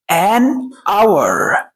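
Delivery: "an", short pause, then "hour"; نطق مفصل. Shown as a demonstration. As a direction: separate "an" from "hour". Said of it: "هنقول إيه؟". ("an" ثم "hour" بوقفة بينهما)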